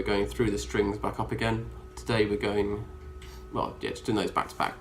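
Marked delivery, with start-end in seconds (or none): none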